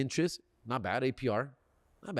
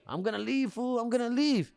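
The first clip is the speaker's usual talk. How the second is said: raised pitch, putting on a threat to go; mock walkout threat; 7 semitones higher than usual. Customer bluffing the dealer about leaving, mock threatening. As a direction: act like you are about to walk out.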